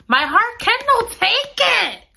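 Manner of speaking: high, slow wail on "My heart cannot take it"; anguished wail. Real, not acted. Reader wailing that the plot breaks her heart. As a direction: cry it out in mock despair.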